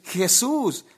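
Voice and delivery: imitated panicked call; mock cry for help. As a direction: cry out the name as if about to crash. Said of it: "estaba a punto de decir".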